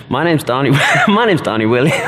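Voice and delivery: hammed-up American voice, cracking up; Donnie. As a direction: attempt an American pastor's accent through giggles. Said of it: "you do your best Donnie".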